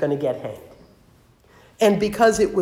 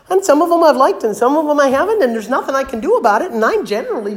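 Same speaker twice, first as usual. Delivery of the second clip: exasperated burst; 9 semitones higher, 8 dB louder, faster than usual. Real, not acted. Preacher exclaims about nominations.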